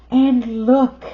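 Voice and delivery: high, loud look; excited call. Real, not acted. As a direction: grab their attention. Excited pointing.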